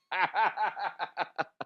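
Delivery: helpless real laugh. Real, not acted. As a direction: burst of high laughter. Cracks up at own impression.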